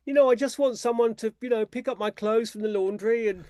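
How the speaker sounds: high, put-on needy voice, stretched out in the middle; mock him asking for a servant, laughing through it; whiny imitation of Lawrence. Author mocking Lawrence wanting a helper, high whiny voice. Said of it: "he'll say things like".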